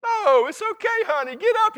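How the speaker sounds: put-on high, soothing coo to a child; tender parent. Parent comforting a fallen toddler, high gentle coo. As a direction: comfort the little one. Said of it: "Is that the way you talk to those kids?".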